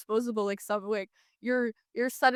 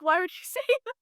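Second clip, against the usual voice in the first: high, playful mock outrage with a laugh; high joking indignation at a backhanded compliment; mock offended; 9 semitones higher, much faster than usual. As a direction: pretend to be hurt while laughing. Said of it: "She was joking".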